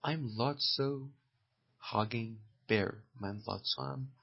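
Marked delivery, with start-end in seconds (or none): 0.0-2.9 slow dictation. Shown as a demonstration. Teacher repeats film line slowly.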